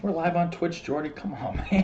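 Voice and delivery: high-pitched, light coaxing with a laugh; playful chiding. Real, not acted. Playfully scolding Jordy while live.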